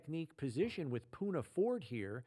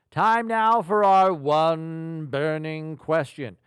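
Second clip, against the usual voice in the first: loud, drawn-out announcer build-up; segment announcement; 9 dB louder, much slower than usual. Acted. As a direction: stretch it out like a big segment intro. Host announces the next show segment.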